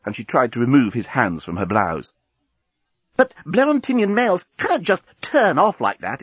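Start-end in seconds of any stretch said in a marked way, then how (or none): 3.2-6.2 high-pitched alien explaining himself; explaining alien journalist. A narrator's reading. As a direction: explain in a higher character voice. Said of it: "explained the journalist".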